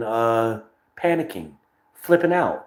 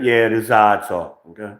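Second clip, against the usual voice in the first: normal talking voice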